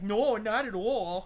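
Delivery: exaggerated Wisconsin accent, slow and drawn out; a hammed-up Wisconsin twang. Shown as a demonstration. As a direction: lay on a thick Wisconsin accent. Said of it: "throw on a weird fucking Wisconsin accent".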